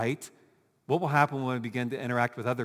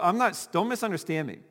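usual speaking voice

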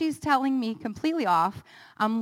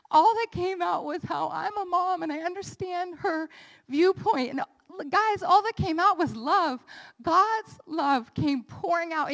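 higher, overwhelmed voice rising with feeling; emotional outpouring; 7 semitones higher than usual. Real, not acted. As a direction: let the emotion lift the voice as love pours out. Testimony, overcome with emotion.

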